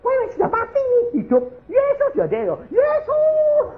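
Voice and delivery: high, loud mock wailing; hysterical yelling. Wife yelling for Jesus over her husband, hysterical yelling. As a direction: cry out frantically, far up in pitch. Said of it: "teriak Yesus".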